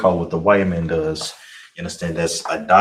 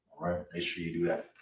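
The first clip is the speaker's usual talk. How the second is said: ordinary talk, nothing marked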